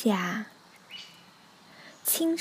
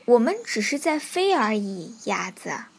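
ordinary delivery

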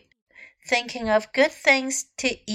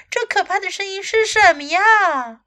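higher, mildly frightened storybook exclamation; alarmed cry; 7 semitones higher, 3 dB louder than usual. A narrator's reading. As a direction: voice a startled dragon crying out. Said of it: "龙叫了起来".

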